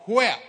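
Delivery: sharp loud impact noise; loud whap. Retold or imitated. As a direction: smack the sound out. Bat hits the fan.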